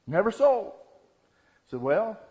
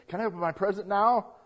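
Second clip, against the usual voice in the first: put-on higher, louder eager kid question; pleading child; 5 semitones higher, 5 dB louder, faster than usual. Johnny asks days before his birthday, pleading. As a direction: beg for it like an impatient little boy. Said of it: "And Johnny says".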